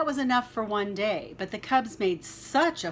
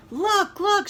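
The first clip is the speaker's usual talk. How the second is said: put-on high, loud children's cry of look, look; excited shout; 11 semitones higher, 7 dB louder than usual. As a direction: call out like eager bear cubs. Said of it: "shouted the cubs".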